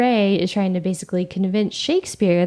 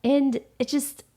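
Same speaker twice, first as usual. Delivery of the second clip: exasperated rant; 6 semitones higher than usual. Real, not acted. Ranting at the writers.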